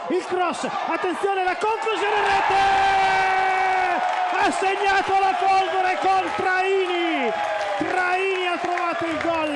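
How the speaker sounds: very high, loud goal scream; breathless italian goal commentary. Real, not acted. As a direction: explode as the ball hits the net. Goal scored, commentator erupts.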